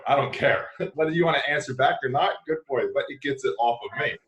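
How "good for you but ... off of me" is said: usual speaking voice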